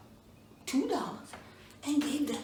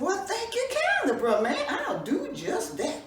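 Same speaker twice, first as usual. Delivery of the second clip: chuckling thanks; 9 semitones higher, 3 dB louder than usual. Acted.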